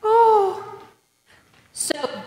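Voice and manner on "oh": short frightened gasp of Oh; scared little cry; cowering plea. Shown as a demonstration. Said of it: "Show me a terrified voice".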